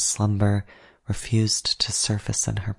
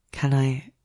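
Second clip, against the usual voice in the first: higher, tentative question left hanging; hesitant, self-conscious unfinished request; embarrassed trailing off; 4 semitones higher than usual. A narrator's reading. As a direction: begin to ask, then leave it unfinished out of embarrassment. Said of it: "I trailed off, embarrassment".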